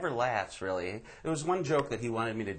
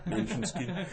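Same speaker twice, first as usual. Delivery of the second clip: put-on German accent; 8 semitones higher, slower than usual. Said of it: "The guys in Germany going".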